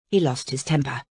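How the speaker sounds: ordinary delivery, nothing marked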